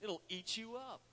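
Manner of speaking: normal talking voice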